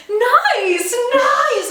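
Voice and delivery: high, giddy mock enthusiasm; overly excited. Teasing friend's impression of her chatting to models, overly excited. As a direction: imitate someone far too keen. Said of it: "you were overly excited about it".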